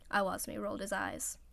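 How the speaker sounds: ordinary delivery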